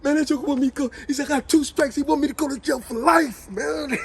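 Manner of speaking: high, loud, heated rant; a man fired up after a fight, ranting; angry rant. Retold or imitated. Jody ranting about jail.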